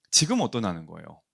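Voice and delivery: normal talking voice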